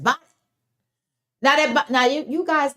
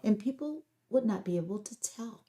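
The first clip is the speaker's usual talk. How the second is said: softer, controlled public-facing tone; professional voice; 4 dB quieter than usual. Shown as a demonstration. Host dealing with the public, professional voice. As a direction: speak softly in careful standard diction. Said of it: "I can talk like this".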